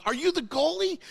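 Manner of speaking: high, loud question of sudden recognition; acted astonishment. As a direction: re-enact the amazed moment of recognising someone. Retelling his astonished recognition of Matt.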